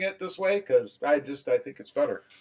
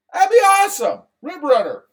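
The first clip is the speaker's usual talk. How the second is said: excited; 10 semitones higher, 6 dB louder than usual. Real, not acted. Repeats approval, higher.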